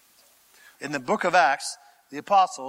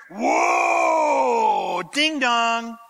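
mock fanfare shout; 7 semitones higher, 3 dB louder, much slower than usual. Mock royal chariot arrival.

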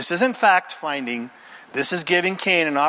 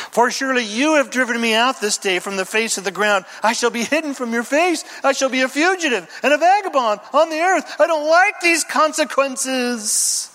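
whiny complaint; 7 semitones higher than usual. Acted. Cain whining to God.

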